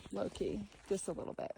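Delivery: hushed, almost breathless whisper; whispered aside. Real, not acted. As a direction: let it slip like a secret. Confiding an aside to viewers.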